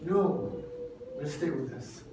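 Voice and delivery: low, very soft determination; hushed, deep-pitched acted conviction; quiet resolve. Blind man's resolve voiced softly.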